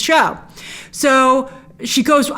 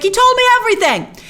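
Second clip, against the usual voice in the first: much higher, hammed-up gloating; smug mock triumph; 11 semitones higher, 3 dB louder than usual. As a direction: crow it with cheeky pride. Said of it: "And she's like".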